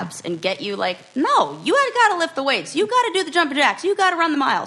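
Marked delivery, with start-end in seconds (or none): 1.1-4.7 loud, fast, high drill-coach barking; pushy trainer. Pretend. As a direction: bark it like a gym coach pushing reps. Mock personal trainer demanding workouts.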